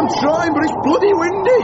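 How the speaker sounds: high, straining voice over wind; strained shout. Acted. Husband struggling with lid in gale, strained shout.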